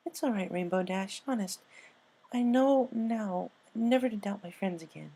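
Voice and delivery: a light, smiling Pinkie; smiling reassurance. Acted. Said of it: "she said with a smile".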